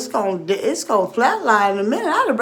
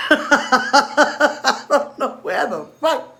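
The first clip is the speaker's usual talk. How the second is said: ordinary talk, nothing marked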